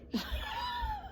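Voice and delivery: a sudden, high, breathy burst of laughter; bitter laugh. Real, not acted. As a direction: laugh in disbelief at your own bad luck. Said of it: "Okay, it's not a funny thing".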